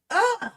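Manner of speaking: high, loud ugh of disgust; disgusted groan. Real, not acted. Vlogger disgusted at the event, high loud groan. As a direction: groan out your revulsion. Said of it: "I'm getting so mad".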